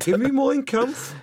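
put-on slow, high Brummie accent; Brummie Nazi voice. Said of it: "Brummie Nazi".

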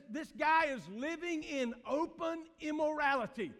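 loud, high preacher's cry; hammered-out pulpit rebuke; full-force preaching. Acted. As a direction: lift it to a rousing shout. Preaching Paul's rebuke, rising.

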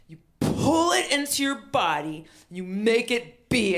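high, loud, impassioned pep talk; rousing exhortation. Retold or imitated. Inspiring woman urging her to make comedy happen, rousing exhortation. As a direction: urge it on like a guru.

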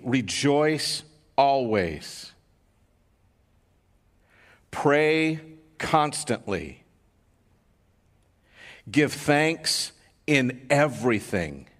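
very slow, weighty verses; slow scripture reading. Acted. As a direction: let each command land slowly. Reads verses slowly.

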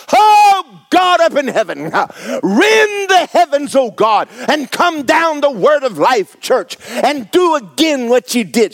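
high, loud, hammed-up wailing prayer; mock pleading prayer. Preacher mocking people who beg God to come down, wailing high and loud. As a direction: ham up a desperate plea to heaven.

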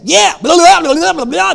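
high, loud gossipy blah blah; mock eager chatter. Friends joining in the complaining, mock eager chatter. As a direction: gush along in agreement. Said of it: "And they'd say".

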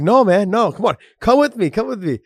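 high and loud, urging; eager pleading. Retold or imitated. DC begging his friend to come meet Seagal, loud and eager. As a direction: come on, beg him to tag along.

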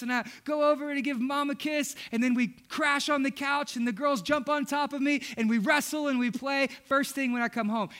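ordinary talk

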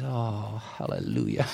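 breathless whispered hallelujah through tears; dying man's tearful whisper of relief. Retold or imitated. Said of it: "Guy starts crying".

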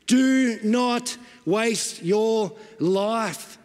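each word separated and drawn out; very slow, word by word, for weight; slow, emphatic warning. Real, not acted. Preacher pleading with the congregation at the climax, slow and emphatic.

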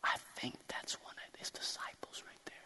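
an unvoiced, hushed whisper. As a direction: whisper it to the person beside her. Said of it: "She might be whispering to somebody".

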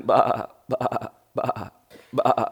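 a lightly bleated bah from the mother sheep; a gentle sheep's bah, not much sound change. Acted. Mother sheep calling her lambs, mild bleat. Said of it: "the mother sheep is going".

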